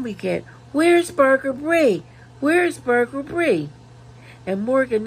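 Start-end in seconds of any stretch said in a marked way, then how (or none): 0.7-3.7 high, loud calling out twice. Retold or imitated. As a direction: call out for her across a room. Reading chat, calling for Brie.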